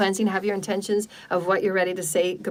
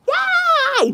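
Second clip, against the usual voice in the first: much higher, loud gleeful yay; cheer; 6 dB louder than usual. Real, not acted. Healer cheering for her listeners, loud cheer.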